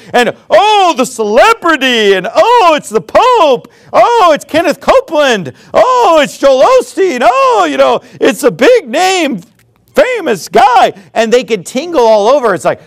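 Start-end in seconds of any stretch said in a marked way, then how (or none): 0.1-10.9 star-struck fan. Retold or imitated. Fans meeting famous preachers, high mock gushing. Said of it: "star-struck fans".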